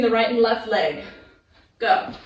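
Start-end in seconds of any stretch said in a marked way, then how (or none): none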